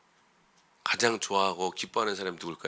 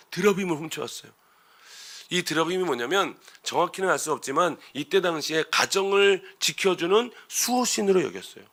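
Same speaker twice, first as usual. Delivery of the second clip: usual speaking voice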